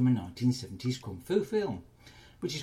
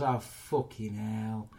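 mock dismay; much slower than usual. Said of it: "finds her friend shot to death and goes".

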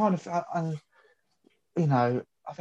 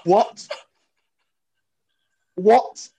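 stunned what; 6 semitones higher, 3 dB louder than usual. Retold or imitated. Stunned reaction to news.

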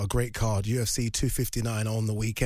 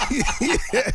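laughing; 10 semitones higher than usual. Real, not acted. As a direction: laugh it off, amused. Laughing at accent attempt.

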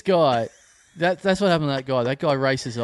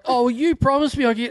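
whining caller; 8 semitones higher than usual. Retold or imitated. Acting out complaining caller.

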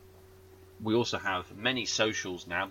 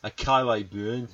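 slow, louder Geordie pronunciation; Geordie accent; 5 dB louder, slower than usual. Acted. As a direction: draw the name out in broad Geordie. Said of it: "I think she might be a Geordie".

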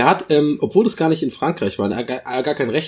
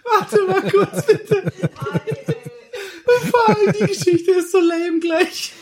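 laughing too hard to breathe, high and slow; helpless giggling fit, gasping between words; breathless laughter; 13 semitones higher than usual. Real, not acted. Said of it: "krieg keine Luft mehr".

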